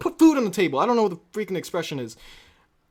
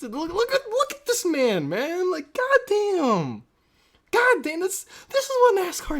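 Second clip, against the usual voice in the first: excited; 10 semitones higher than usual. Real, not acted. Fired up over driver's video.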